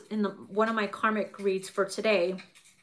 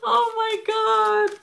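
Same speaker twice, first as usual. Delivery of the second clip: slow, high, wheezing through laughter; laugh-crying; 11 semitones higher, 4 dB louder, slower than usual. Real, not acted. Reader overcome with laughter, high slow wail. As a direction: cry it out while laughing helplessly.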